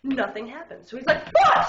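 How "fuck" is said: sudden high yelled curse; panicked curse. Retold or imitated. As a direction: blurt it out in a loud panic. Driver realizes brakes failed.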